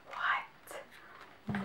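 breathless whispered what; stunned whisper. Real, not acted. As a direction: whisper it in disbelief. Stunned whisper over gift box.

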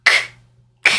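a bare K with no vowel; isolated K sound. Shown as a demonstration. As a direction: click out K alone, a pause between each. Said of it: "these two letters together sound like".

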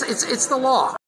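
ordinary delivery

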